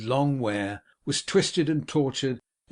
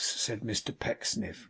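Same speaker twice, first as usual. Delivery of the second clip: plain reading voice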